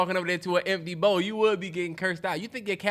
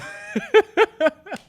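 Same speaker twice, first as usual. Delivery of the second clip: high burst of laughter; cracking up loud and high; 6 dB louder than usual. Real, not acted. Cat talks, he cackles.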